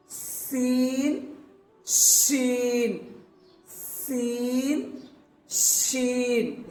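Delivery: سین with a sharp s and شین with a hushing sh, each slowly drawn out; slow contrasting سین then شین; seen and sheen contrast. Shown as a demonstration. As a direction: alternate سین and شین, stretching the first sound. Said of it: "اور یہ شین".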